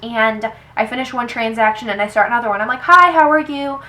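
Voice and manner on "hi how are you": higher, louder customer-service hello; cashier's greeting. Retold or imitated. Cashier to the next customer at the register, chirpy. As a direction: imitate a routine chirpy checkout greeting.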